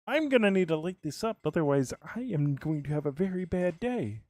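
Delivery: high, strained mock worry; hammed-up high-pitched dread; high put-on voice. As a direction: pitch it way up, fretting playfully. Playful worry about dark game area.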